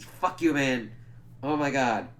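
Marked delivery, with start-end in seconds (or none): none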